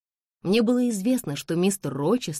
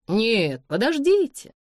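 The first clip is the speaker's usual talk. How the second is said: interrupting; 7 semitones higher, 4 dB louder, slower than usual. A narrator's reading. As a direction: cut in with a raised, higher voice. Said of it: "прервал его полковник Дент". (перебивая, повысив голос)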